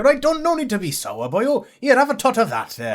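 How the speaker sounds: high, jovial Welsh offer; Welsh accent. Retold or imitated. Welsh seaman offering his flask to a sour passenger, Welsh accent. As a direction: coax the sulky passenger with a drink, Welsh lilt. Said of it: "he's like".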